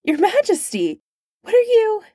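high, sharp alarmed yelp. A narrator's reading. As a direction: cry it out in sudden alarm.

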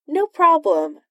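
high, cheery reply; cheerful. A narrator's reading. Tony agreeing to Doom's demand, high and cheerful. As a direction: toss it out brightly, pitched well up. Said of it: "said Tony cheerfully".

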